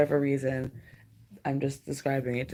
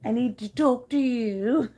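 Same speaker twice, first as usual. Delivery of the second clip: high, lightly put-on cat voice; cat's imagined chatter, lightly voiced; voicing the cat; 6 semitones higher than usual. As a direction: speak for the cat, eager and chatty. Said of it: "she's just like".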